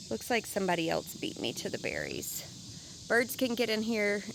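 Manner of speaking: normal talking voice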